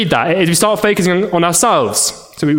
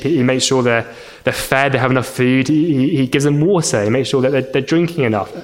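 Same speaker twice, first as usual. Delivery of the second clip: ordinary talk, nothing marked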